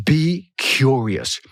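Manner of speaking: BE CURIOUS drawn out very slowly; slow, lingering "Be... curious"; savoring the words. Shown as a demonstration. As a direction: stretch out "Be curious", relishing each word. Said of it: "Oh, I love that word".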